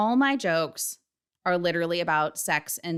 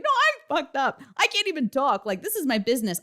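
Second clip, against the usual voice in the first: mock sobbing cry, much higher; retold bawling; 7 semitones higher than usual. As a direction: replay the tearful wail from the bathroom. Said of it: "I was bawling and I was like".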